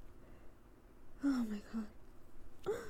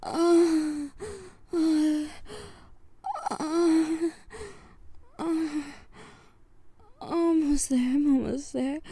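loud straining; 2 semitones higher, 5 dB louder, much slower than usual. Real, not acted.

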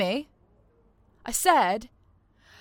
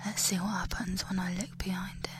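soft, close whispered taunts; menacing whisper; 12 dB quieter than usual. Acted. Captor whispering cruel taunts into the captive's ear.

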